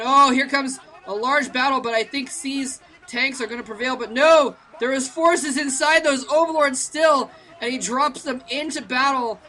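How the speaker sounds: urgent esports caster at a turning point; excited play-by-play. Real, not acted. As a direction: call the big clash as it unfolds, voice up. Commentator calls big battle live.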